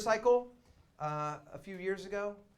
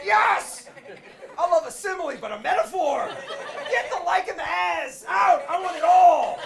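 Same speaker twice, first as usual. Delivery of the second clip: very high, loud laughing shouts; ecstatic shout; 13 semitones higher, 13 dB louder than usual. Retold or imitated. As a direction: scream with delight over metaphors. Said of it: "I'm just like".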